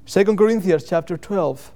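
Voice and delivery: ordinary talk, nothing marked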